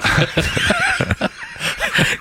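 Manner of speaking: high burst of laughter; big laugh. Real, not acted.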